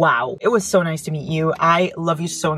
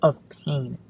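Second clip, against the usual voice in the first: dramatic title read; 3 semitones lower, much slower than usual. Pretend. Reads the book title dramatically.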